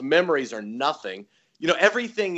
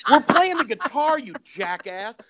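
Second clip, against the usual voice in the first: loud, high incredulous retort; exasperated; 9 semitones higher, 4 dB louder than usual. Retold or imitated. Toby scolding Nugent over the ammunition, exasperated. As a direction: burst out in mock exasperation.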